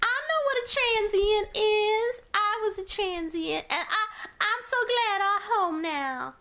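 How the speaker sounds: high, put-on old-lady voice, playfully reminiscing; old mama voice. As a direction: ham it up as a quirky old mama. Voices a transient old mother figure.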